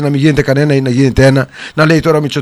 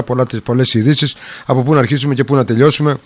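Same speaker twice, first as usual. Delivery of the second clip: usual speaking voice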